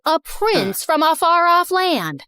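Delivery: dramatic reveal. A narrator's reading. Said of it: "wait for it".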